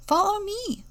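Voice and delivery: bright leading call. A narrator's reading. Louise leads the way.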